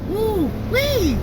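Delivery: high, whooping exclamation; excited whoop. Real, not acted. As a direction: let out a delighted high whoop. Exclaims about the deli lady.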